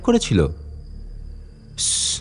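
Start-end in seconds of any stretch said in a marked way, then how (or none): 1.8-2.1 a sharp hush through the teeth; shushing. Acted. Guide hushes the curious visitor, sharp shush. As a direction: hiss them quiet, urgently.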